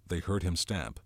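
usual speaking voice

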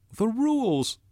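very high, loud shout. A narrator's reading. Ralph appeals to Jack, shouted. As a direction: shout it out in desperate protest. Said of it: "shouted Ralph".

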